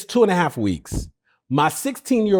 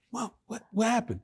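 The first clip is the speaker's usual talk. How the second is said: high, alarmed question; anxious teen; 8 semitones higher, 8 dB quieter than usual. Retold or imitated. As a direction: sound worried about what mom will say. Worried teen asks mom.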